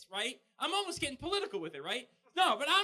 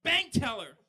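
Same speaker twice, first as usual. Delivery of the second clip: loud, high mock curse yell; 6 semitones higher, 6 dB louder than usual. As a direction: blurt a fake curse. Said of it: "I just yell out".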